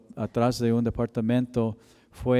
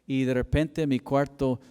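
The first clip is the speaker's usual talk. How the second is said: normal talking voice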